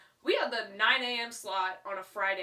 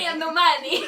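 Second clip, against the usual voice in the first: very loud, raised playful lament; mock projected cry; 5 semitones higher, 13 dB louder than usual. Host joking about being broke, mock projected cry. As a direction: belt it out for the back row.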